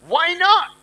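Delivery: high-pitched mock kid protest; protesting child. Child questions parents' refusal, protesting. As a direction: imitate a child objecting, pitched well up.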